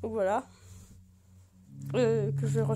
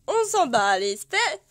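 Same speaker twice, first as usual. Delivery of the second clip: louder, slower mock-dismissive "On s'en bat les steaks"; 3 semitones higher, 8 dB louder than usual. Real, not acted. Waving off the Animal Crossing items to viewers, loud dismissive. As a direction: shrug it off loudly and slowly.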